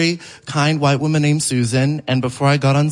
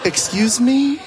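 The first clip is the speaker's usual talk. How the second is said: high incredulous question; shocked excuse me; 9 semitones higher, slower than usual. Retold or imitated. Comic stunned at the boyfriend's question, high-pitched. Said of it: "I was like".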